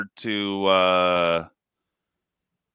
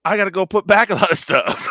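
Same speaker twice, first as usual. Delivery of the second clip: higher, louder, fast mock panic; mock dismayed shopper; 9 semitones higher, 5 dB louder, faster than usual. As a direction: rush it out like a caught-out shopper. Mock quote of shopper's regret.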